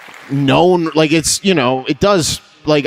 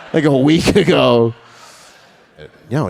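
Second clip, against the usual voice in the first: ordinary talk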